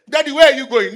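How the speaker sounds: hammed-up child calling after her father; daughter calling out. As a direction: call after daddy like a little girl.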